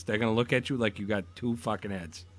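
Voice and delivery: ordinary talk, nothing marked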